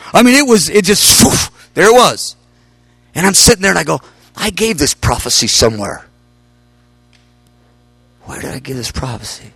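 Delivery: ordinary delivery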